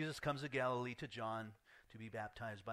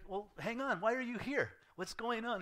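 much higher, a bit louder, alarmed questioning; startled John; 10 semitones higher, 5 dB louder than usual. Retold or imitated. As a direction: stop him in alarm, pitched up. John blocking Jesus, retold.

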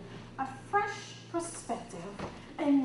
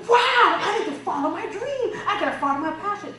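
higher, louder, starry-eyed gush; excited; 8 semitones higher, 12 dB louder than usual. Retold or imitated. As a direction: gush like a dazzled attendee. Excited conference attendee quoted.

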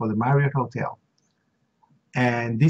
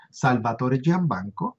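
ordinary talk

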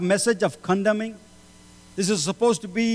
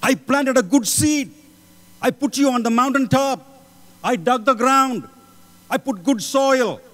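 raised, louder voice of the Lord to his people; the Lord pleading; 5 semitones higher, 3 dB louder than usual. Acted. The Lord asking his people where the fruit is, raised and louder. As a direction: speak as God disappointed in his vineyard. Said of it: "And he says".

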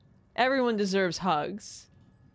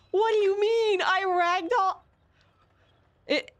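incredulous; 11 semitones higher, 3 dB louder than usual. Real, not acted. Incredulous at ragdoll.

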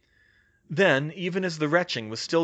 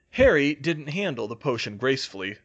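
plain reading voice

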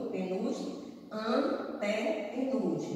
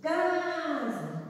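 Casa with CA high and held; stress on first syllable; 6 semitones higher than usual. Shown as a demonstration. Teacher showing the strong syllable of Casa, CA drawn out. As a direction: stretch the "Ca" of "Casa". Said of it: "é o K".

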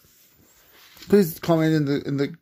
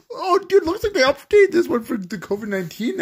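high-pitched, surprised outburst; 12 semitones higher, faster than usual. Real, not acted. Player spots an updated game answer, high surprised voice. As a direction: pitch leaps up in sudden surprise.

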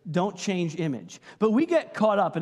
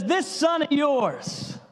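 slow contemptuous jab; 8 semitones higher, much slower than usual. Retold or imitated. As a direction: drag out 'This son of yours' slowly with disdain. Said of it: "He says".

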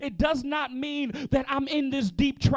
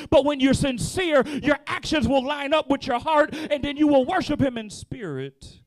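louder, rising preacher's cadence; fervent preaching; 3 semitones higher, 5 dB louder than usual. Acted. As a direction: drive it home to the congregation. Preaching climax.

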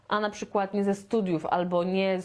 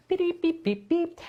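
car beeps; 8 semitones higher than usual. Retold or imitated. Imitating cars racing.

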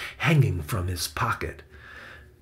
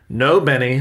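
put-on louder, quick stop to the boy; firm objection; 3 semitones higher, 7 dB louder than usual. A narrator's reading. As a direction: cut in with a mild warning. Uncle stops boy taking photo.

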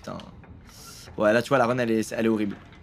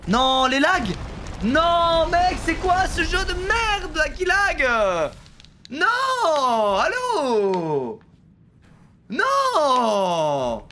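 high despairing wail at the lag; raging gamer; 12 semitones higher, much slower than usual. Real, not acted. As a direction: howl in frustration, voice breaking. Losing a run to lag. (joueur en rage qui hurle)

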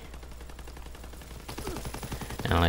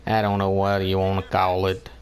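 normal talking voice

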